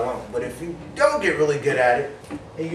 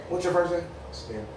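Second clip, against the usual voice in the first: ordinary talk, nothing marked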